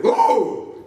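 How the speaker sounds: loud startled creature cry; pterodactyl cry. Acted. Pterodactyl yelping as its tail is grabbed. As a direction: yelp like a grabbed beast. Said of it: "the pterodactyl went".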